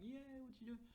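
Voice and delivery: high-pitched perky question; put-on high, friendly chirp. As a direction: lift the voice way up like an eager AI. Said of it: "like, raising voice".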